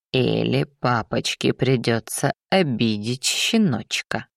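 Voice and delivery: put-on low, cooing baby talk; lisping coo with menace underneath; fire demon. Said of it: "Просюсюкал беловолосый".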